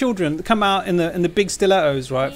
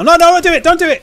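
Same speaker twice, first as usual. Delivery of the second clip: high, loud panicked pleading; real panicked shout; frantic shouting; 12 semitones higher, 11 dB louder than usual. Real, not acted. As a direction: yell at the screen to stop it. Pleading at screen during play.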